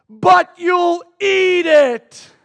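high, slow mock-child shout; toddler protest. Three-year-old accusing his father, shouted mock outrage. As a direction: wail it like an outraged toddler, every word stretched.

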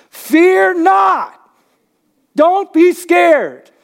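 loud, high preacher's shout; yelled command. Shown as a demonstration. Preacher yelling fear not at the congregation from stage, shouted. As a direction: bark it out at full voice, drawn out. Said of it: "hear somebody yelling on stage".

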